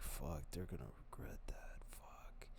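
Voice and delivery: hushed whispered curse to himself; whispered cursing. Retold or imitated. Watching people blow up, he whispers a curse to himself. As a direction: breathe the curse under your breath. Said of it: "I'd be like".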